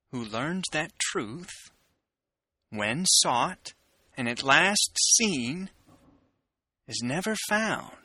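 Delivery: dramatic poetry recitation with long pauses; poem reading. Acted.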